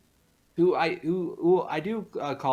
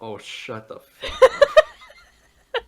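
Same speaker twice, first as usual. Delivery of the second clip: drawn-out retort breaking into loud laughter; laughing retort; 10 dB louder, much slower than usual. Real, not acted. Guest teased by host about Zav, laughing retort. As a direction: tell them off while cracking up.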